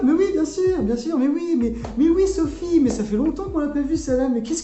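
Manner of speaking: high, loud, gushing party voice; festive light voice. Shown as a demonstration. Greeting friends on a festive day, high and gushing. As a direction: gush like running into old friends. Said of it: "je vais plutôt prendre cette voix-là".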